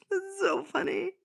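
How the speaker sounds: high, loud helpless laughter; laughing hard. Real, not acted. Reading a funny chat comment, laughing high and loud. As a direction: crack up while talking. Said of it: "why that made me laugh so much".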